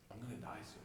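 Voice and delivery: very soft, grave quote; hushed revelation. Acted. Explaining what Mu'adh understood from the Prophet, hushed. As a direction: let the sad news land almost under the breath. Voicing the farewell's hidden meaning.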